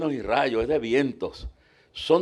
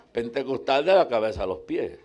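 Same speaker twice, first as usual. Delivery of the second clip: usual speaking voice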